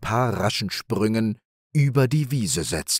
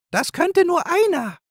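very high, squeaky squirrel; a tiny high squirrel voice, sure of itself; 4 dB louder than usual. A narrator's reading. The squirrel tells the animals, very high and squeaky. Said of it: "entgegnete das Eichhorn".

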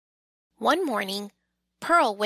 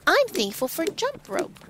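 much higher, a cheerful yell; 9 semitones higher than usual. Acted. Pearl yells gleefully to Squirrel at the playground. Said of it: "Pearl yells".

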